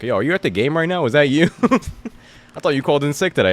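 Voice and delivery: high, loud incredulous boss voice; manager texting. Retold or imitated. Manager spotting him at the game on a sick day, incredulous. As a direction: call him out, half-laughing. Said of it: "My manager texted me like".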